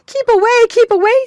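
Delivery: high shrill-free scream, loud and urgent. A narrator's reading. Sisters screaming at the third sister to stay away. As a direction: scream at her to stay back. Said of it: "screamed the other two".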